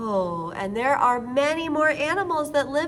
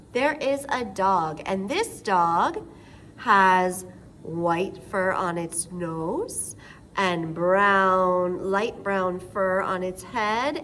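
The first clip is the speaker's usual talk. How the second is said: ordinary delivery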